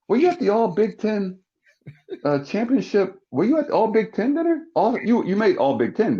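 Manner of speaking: high-pitched probing questions; retold high, searching voice; puzzled questioner. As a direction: imitate a baffled teammate fishing for answers. Draft picks probe the unknown player.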